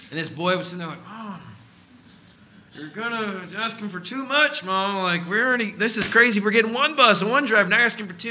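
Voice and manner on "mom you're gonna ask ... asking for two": put-on higher, louder, exasperated teenage protest; a boy mortified by his mom; embarrassed son. Son embarrassed at mother's asking.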